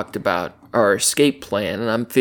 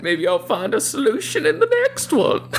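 put-on old prospector; 10 semitones higher than usual. Drunk prospector voice.

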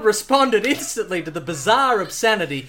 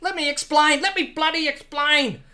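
Australian shout; 7 semitones higher than usual. Acted. Pilot struggling under Bond's rope, Australian yell. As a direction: shout back in a broad Aussie voice. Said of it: "shouted the pilot".

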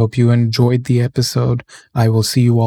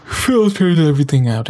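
words stretched by a yawn, pitch sliding down; 4 semitones higher than usual. Real, not acted. Podcaster yawning while explaining the mic to listeners, yawning. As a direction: talk through a real yawn. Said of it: "Excuse me for the yawn".

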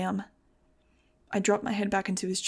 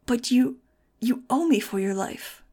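stammered; 4 semitones higher, 4 dB louder than usual. Acted. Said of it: "Doctor G H stammered".

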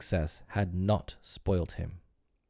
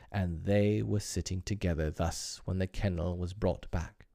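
ordinary delivery

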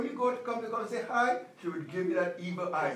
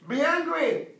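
louder, higher, hit it like an order; emphatic command; 6 semitones higher, 4 dB louder, slower than usual. Acted. Pastor commanding the congregation, emphatic preaching shout. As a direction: bark the command at the church.

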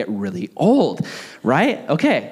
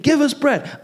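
high whiny demand; whiny crowd; 12 semitones higher than usual. Retold or imitated. Pastor voicing the crowd's demands: high whiny plea. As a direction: whine it like a greedy crowd. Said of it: "He just keeps saying".

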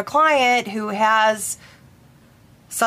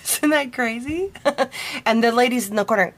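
ordinary delivery

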